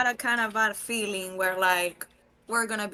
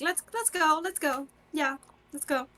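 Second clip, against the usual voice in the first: urgent; 7 semitones higher than usual. Acted. Player voicing Pearl urging.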